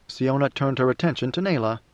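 ordinary delivery, nothing marked

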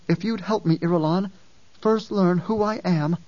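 put-on a child overwhelmed by her memories, pleading; girl's voice breaking into an anguished cry; anguished young girl. Ganima in anguish.

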